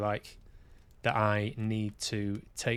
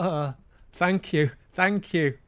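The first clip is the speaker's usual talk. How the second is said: higher, a touch louder, meek thanks; mock grateful self; 8 semitones higher, 6 dB louder, slower than usual. Him answering the rescuer with meek, higher thanks. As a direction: play a bashful, grateful reply. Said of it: "And I go".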